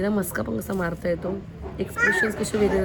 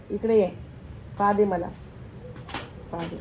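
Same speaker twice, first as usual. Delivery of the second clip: ordinary talk, nothing marked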